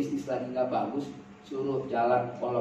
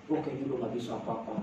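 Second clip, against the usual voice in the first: soft, lower, quick admission; mock defeated husband; 4 semitones lower, 9 dB quieter, faster than usual. Husband admits helplessness to wife, mildly defeated and soft. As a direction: imitate a man giving up, softly.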